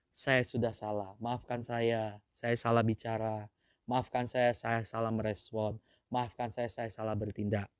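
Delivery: soft, low, contrite apology; humble confession. Shown as a demonstration. Preacher modelling for the congregation, soft and contrite. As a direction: model a sincere apology softly. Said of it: "kita harus berkata".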